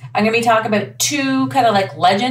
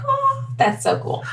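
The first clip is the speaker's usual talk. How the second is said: awed quote; 3 dB quieter, slower than usual. Retold or imitated. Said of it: "I'm like".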